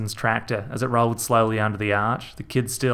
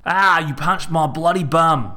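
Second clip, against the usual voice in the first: slow, louder yelp of complaint; pained outburst; 6 semitones higher, 5 dB louder than usual. A narrator's reading. Reg crying out after being punched. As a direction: cry out indignant.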